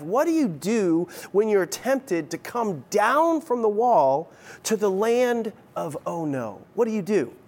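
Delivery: usual speaking voice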